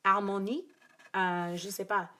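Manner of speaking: attempted French accent, unsure; fake French accent. Real, not acted. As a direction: try the French words in a put-on French accent. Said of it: "I use my fake French accent".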